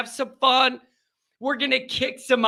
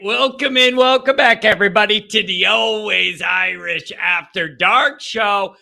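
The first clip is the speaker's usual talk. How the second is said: show-opening announcer; 5 dB louder than usual. Acted. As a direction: open the show big and loud, stretching key words.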